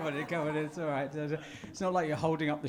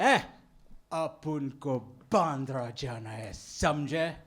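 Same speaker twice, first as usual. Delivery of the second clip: slow, low Mumbai tapori Hindi; mock tough Bombay slang; Bombay street tough; 5 semitones lower, much slower than usual. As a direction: play a hard Mumbai street guy. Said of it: "let me get in this".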